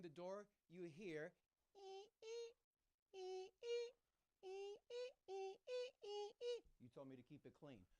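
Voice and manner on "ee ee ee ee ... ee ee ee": high squeaky noises. Retold or imitated. Teen behind door, imitated noise.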